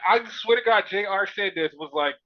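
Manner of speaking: ordinary talk, nothing marked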